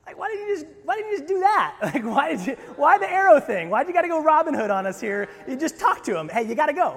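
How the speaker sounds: loud, high-pitched mock bafflement; comic exasperation. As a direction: protest in a hammed-up, squeaky-high outburst. Comic exasperation at the arrow plan.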